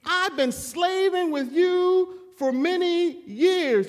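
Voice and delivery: high, loud and slow, a sulking son's grievance; prideful complaint. Retold or imitated. Preacher voicing the elder son complaining to his father, high, loud, slow. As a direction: drag it out like a wounded older brother. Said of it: "he becomes prideful because he says".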